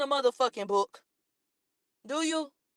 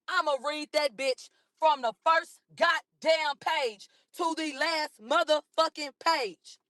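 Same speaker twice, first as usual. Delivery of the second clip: slow, punched "first goddamn page" and "last motherfucking page"; slow, defiant word-by-word emphasis; emphatic vow; 3 semitones higher, slower than usual. Real, not acted.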